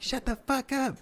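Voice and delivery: very high falsetto crowd chant; mock shout in a thin high voice; mimicked crowd yell. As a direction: answer as a whole room, squeaky and high. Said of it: "they're like".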